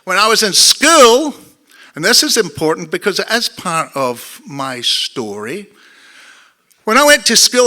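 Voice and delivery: broad Glaswegian storytelling; Scottish accent. Shown as a demonstration. Telling the audience about school in dark Glasgow, Scottish accent. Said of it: "I can still speak like, like this".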